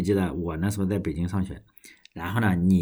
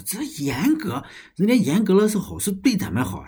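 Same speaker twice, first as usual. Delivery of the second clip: imitating the father, much higher and slowed on the opening words; proud old father; 10 semitones higher than usual. Son mimics his farmer father defending the strict checks, proud old-man voice. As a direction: put on the father's proud, approving tone.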